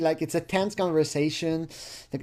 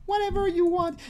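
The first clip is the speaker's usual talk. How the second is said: mock girly voice. Mocking her uninvolved chat reply in falsetto.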